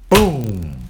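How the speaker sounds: imitated boom. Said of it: "I heard".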